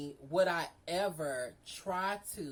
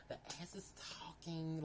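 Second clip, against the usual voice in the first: softer, breathless whispered aside; cheeky whisper; 10 dB quieter than usual. Real, not acted. Confiding a racy detail to viewers, whispered. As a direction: whisper it like a naughty secret.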